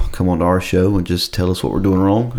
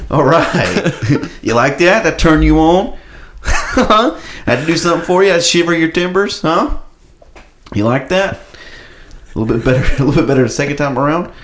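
laughing playful ASMR; 6 semitones higher than usual. Acted. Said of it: "do the whole A S M R thing again".

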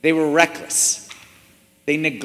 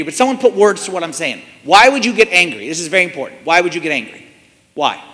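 normal talking voice

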